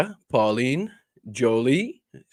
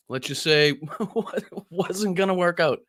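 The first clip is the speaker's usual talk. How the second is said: slow, higher, broken by laughter; laughing; 6 semitones higher than usual. Real, not acted. Host admitting the shirt plan failed, laughing. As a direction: laugh through the admission. Amused by own shirt mistake.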